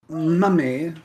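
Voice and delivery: a hint of a snooty private school brat; pompous posh brat. Lightly voiced posh schoolboy whining that nobody can have it. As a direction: sneer it like an entitled rich kid. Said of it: "yours is more posh ... pompous private school dickhead".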